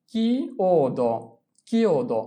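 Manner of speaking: কিওদো drawn out very slowly, each sound apart; very slow model pronunciation. Shown as a demonstration. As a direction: spell out কিওদো slowly for students. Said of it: "teacher models pronunciation".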